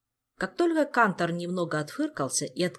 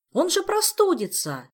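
high, loud worried protest; alarmed cry; 12 semitones higher, 6 dB louder than usual. A narrator's reading. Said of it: "снова вскричал Жак".

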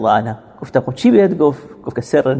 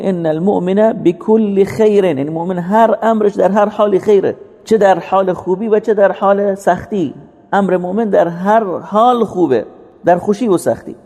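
high, measured sermon cadence with stretched Arabic phrases; impassioned preaching; 6 semitones higher, slower than usual. Real, not acted. Preacher telling the congregation all is good for the believer, impassioned preaching. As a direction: proclaim the hadith slowly, pitched well up.

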